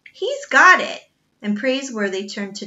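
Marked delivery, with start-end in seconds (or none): 0.1-1.0 loud, higher accusing yell; accusing shout. A narrator's reading. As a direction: bark the accusation at the butler. Cook accuses the boy.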